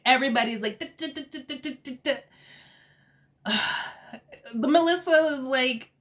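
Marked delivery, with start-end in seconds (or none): 0.8-3.5 soft, higher staccato "duh" noises; quiet repeated stutter sounds; mock stammering. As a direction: imitate a roomful of people lost for words. Imitating everybody's speechless stammering.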